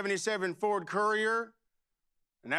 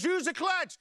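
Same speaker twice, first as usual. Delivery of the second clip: much higher, urgent retold holler; exasperated yell; 10 semitones higher than usual. Father yells at son grinding gears, exasperated.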